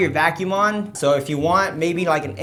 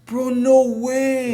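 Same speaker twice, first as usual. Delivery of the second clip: frat bro; 5 semitones higher, much slower than usual. Real, not acted. Amazed at the earwax.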